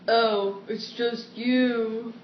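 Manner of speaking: slow, drawn-out acted relief; dramatic script line. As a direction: stretch it out after a false alarm. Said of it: "I'll read you a dramatic scene".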